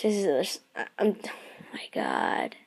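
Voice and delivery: stunned, breathless whisper. Real, not acted. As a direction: whisper it, lost for words. Whispered awe.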